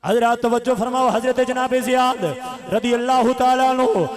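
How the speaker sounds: loud, ringing sermon chant; full-force preaching. A narrator's reading. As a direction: preach the battle story at full volume. Preaches the closing scene.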